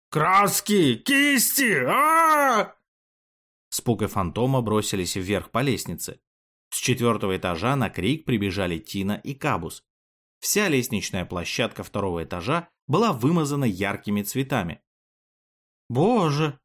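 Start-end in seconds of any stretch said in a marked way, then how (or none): 0.1-2.7 very high, loud shriek of despair; anguished yell. A narrator's reading. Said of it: "Но вопль, последовавший за шагами". 15.9-16.6 higher gasped-out cry of alarm; shocked exclamation. A narrator's reading. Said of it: "воскликнула Тина".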